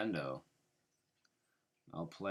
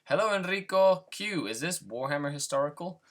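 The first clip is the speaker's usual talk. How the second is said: much higher, louder bright greeting; excited; 11 semitones higher, 9 dB louder than usual. Real, not acted. Reading viewer comments aloud.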